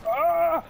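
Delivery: high loud startled ah; sudden yelp. Real, not acted. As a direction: yelp out in surprise. Sudden yelp during game.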